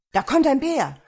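loud, high-pitched guard's warning; alarmed shout. A narrator's reading. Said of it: "schrie einer der Wächter".

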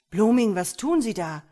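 loud, high, sharp challenge; shouted confrontation; snapping at him. A narrator's reading. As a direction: snap at him in alarm. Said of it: "fuhr ihn Dwyer an".

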